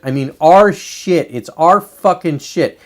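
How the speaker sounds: slow, leaning hard on "our shit"; emphatic insistence. Real, not acted. Vlogger insisting to viewers it's America's, slow and emphatic. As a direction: drive home "our" and "shit" word by word. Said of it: "When I say our shit".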